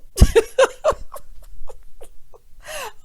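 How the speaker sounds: high, breathless laugh; helpless laughter trailing into wheezes; cracking up. Real, not acted. Host cracking up at her own impression, high wheezing laugh.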